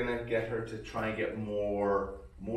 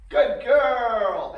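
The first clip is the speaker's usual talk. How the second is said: high praise; 12 semitones higher, 11 dB louder than usual. Real, not acted. Praising dog in training.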